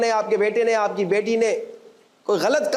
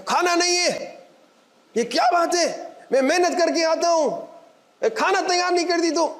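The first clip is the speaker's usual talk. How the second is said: high-pitched, indignant complaint about dinner; angry husband; 9 semitones higher than usual. Acted. Husband complains to wife that food isn't ready, angry. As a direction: act out a husband's raised-pitch outburst. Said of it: "غصہ آ گیا".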